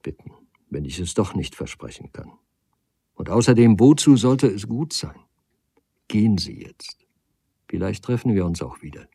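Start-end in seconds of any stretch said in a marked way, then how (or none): 6.1-7.1 breathless whispered send-off; a whispered plea to leave. A narrator's reading. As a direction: barely voice it, letting him go. Soft parting words to suitor.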